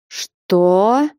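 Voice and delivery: understated gasp of disbelief; startled cry. A narrator's reading. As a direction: cry out in restrained shock. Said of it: "вскрикнула Манефа".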